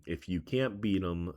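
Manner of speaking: usual speaking voice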